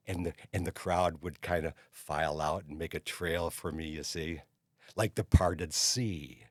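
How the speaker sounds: plain reading voice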